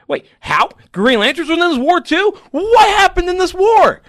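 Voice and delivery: high, loud and pleading in disbelief; surprised eager reader. Retold or imitated. Reliving his reaction to the comic, high surprised pleading. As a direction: beg to know what happened in the war. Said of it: "I'm like".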